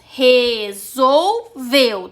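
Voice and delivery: "Resolveu" split into slow, drawn-out syllables; sílaba por sílaba. Shown as a demonstration. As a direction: say "Resolveu" very slowly, one syllable at a time. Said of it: "você separou em três sílabas". ("Resolveu" bem devagar, separando as sílabas)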